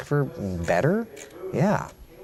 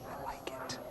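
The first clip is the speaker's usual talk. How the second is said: soft, voiceless whisper; under your breath, whispered; whispered approval; 5 semitones higher, 20 dB quieter than usual. Real, not acted. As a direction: whisper it as an aside. Savouring a recipe idea.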